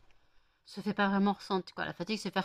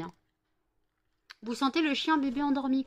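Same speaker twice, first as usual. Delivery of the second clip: ordinary talk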